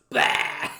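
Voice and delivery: loud short "bah" like a possessed man; mock possessed bark "bah". Old man pretending to be possessed, loud mock bark. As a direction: bark a sudden scary "bah". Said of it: "and he goes".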